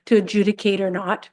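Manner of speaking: usual speaking voice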